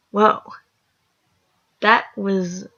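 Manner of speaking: ordinary delivery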